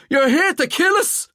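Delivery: high, loud panicked scream; terrified scream. A narrator's reading. Sean screaming in fear at the intruders. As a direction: scream it in terror. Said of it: "Sean screamed".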